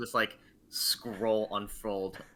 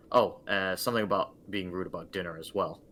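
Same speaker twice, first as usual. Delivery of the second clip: normal talking voice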